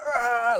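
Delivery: high mock roar of annoyance; mega annoyed growl. Builders hearing about the girls, hammed-up annoyed roar. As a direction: imitate furious builders with a wordless cry. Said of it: "mega annoyed".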